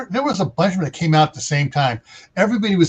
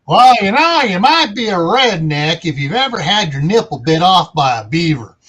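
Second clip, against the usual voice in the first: hammed-up cowboy twang with a big swooping opening; cowboy accent; 4 dB louder than usual. S1 joking on request for a cowboy accent, hammed-up cowboy accent. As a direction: put on a terrible cowboy accent, rising and falling. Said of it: "Cowboy accent".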